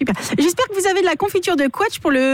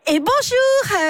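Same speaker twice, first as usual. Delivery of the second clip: southern accent attempt; 7 semitones higher, much slower than usual. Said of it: "Dans le Sud, on dit toujours et bonjour".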